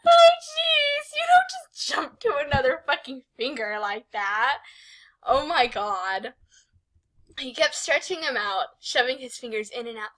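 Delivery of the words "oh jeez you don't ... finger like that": ordinary talk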